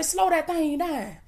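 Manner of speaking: hushed, slowed-down urging; quiet slow command. Shown as a demonstration. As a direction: ease it down gently like you're calming folks. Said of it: "Y'all tell these folk, baby, say".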